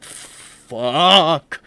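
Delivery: loud drawn-out curse, acted; sudden overwhelmed outburst. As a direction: let the curse burst out as if overcome. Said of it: "you were like".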